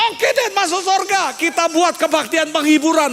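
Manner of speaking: high, loud eager suggestion; acted child's enthusiastic reply; lightly voiced child. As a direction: pipe up like a keen child.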